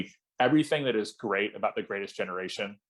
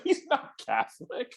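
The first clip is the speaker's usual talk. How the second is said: incredulous; 13 semitones higher than usual. Retold or imitated. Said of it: "It's like".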